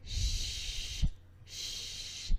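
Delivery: long unvoiced sh hiss; sh sound. Shown as a demonstration. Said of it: "Your voice box is turned off".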